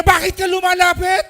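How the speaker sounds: very loud, high, hostile demand; mock angry challenge. Preacher mimics a hostile person confronting someone approaching, very loud. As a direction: bark it at someone who only stepped closer. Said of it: "Yung lalapit ka pala".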